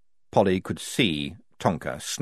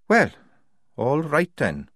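grudgingly; 5 semitones higher, slower than usual. A narrator's reading. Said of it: "she said grudgingly".

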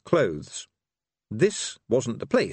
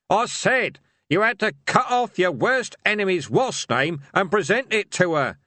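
loud repetition; 10 semitones higher, 4 dB louder than usual. A narrator's reading. Repeating loudly for deaf Hamish.

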